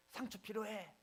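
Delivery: high mock yell from the old grandfather; retold shout. Grumpy grandfather yelling at the pastor at the door, high mock yell. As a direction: bark it out like a gruff grandpa. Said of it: "이렇게 소리를 지르시는 겁니다".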